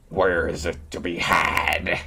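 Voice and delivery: slow, eager wolf question; the wolf asks hungrily, slowly; lightly voiced wolf. A narrator's reading. Hungry wolf answering Tom Thumb, slow lightly voiced. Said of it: "asked the wolf".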